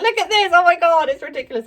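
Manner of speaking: high, loud re-enacted shriek; excited shouting. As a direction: shout to your friends about an outrageous find. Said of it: "I was just shouting".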